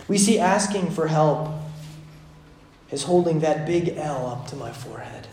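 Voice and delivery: ordinary talk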